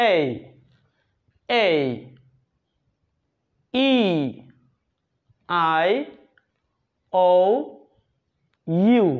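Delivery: "এই, ই, আই, ও, ইউ" each drawn out separately; long, gliding English vowel names, one at a time; long vowel sounds. Shown as a demonstration. Teacher modelling correct vowels for viewers, long and separate. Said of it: "সঠিক উচ্চারণ হলো".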